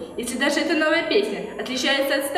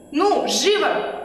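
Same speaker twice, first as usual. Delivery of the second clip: slow, stretched imperious order; commanding; 2 semitones higher, much slower than usual. Acted. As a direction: bark the order slowly. Queen orders servant.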